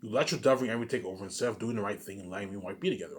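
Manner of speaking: usual speaking voice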